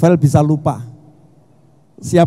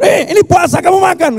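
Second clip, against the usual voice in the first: mock scolding; 11 semitones higher than usual.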